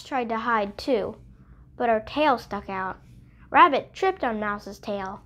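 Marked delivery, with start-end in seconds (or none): none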